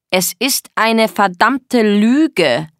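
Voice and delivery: very slow, building in force; angry accusation. Acted. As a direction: drag out each accusing word. Er beschuldigt sie. (wütend, gedehnt)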